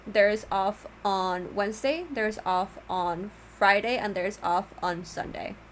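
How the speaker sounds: usual speaking voice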